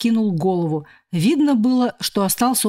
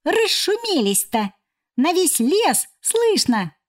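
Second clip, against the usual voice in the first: squeaky cockroach voice; 9 semitones higher, slower than usual. A narrator's reading. Cockroach scolding the noisy friends.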